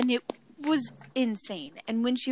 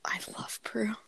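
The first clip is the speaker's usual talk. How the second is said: whispered, much softer aside; hushed confession; 10 dB quieter than usual. Real, not acted. Co-host admitting she loves the new judge, whispered. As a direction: confide it in a whisper.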